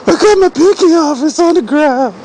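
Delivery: high, loud, whimpering complaint; mock whiny victim. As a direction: whine like a wimp over a finger.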